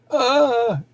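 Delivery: a short high yelp of pain, imitating the guy; mock pained yelp. Retelling the guy her sister floored, a high mock yelp of pain. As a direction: cry out like your fingers are bent back. Sister bends guy's fingers.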